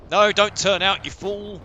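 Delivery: high, loud frantic yell; shouting. Real, not acted. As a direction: yell at him to stop. Yelling at crew mid-fight.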